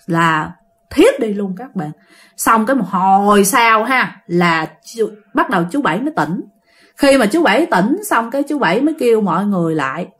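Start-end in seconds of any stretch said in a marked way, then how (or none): none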